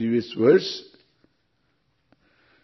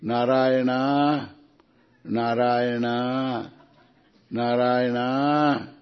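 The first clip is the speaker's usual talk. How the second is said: slow, long-drawn call of a name; calling one's son; 2 semitones lower, much slower than usual. Acted. A dying father calls his son at the last minute, drawn-out calling. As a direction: stretch out the name like a parent calling. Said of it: "you will call your son".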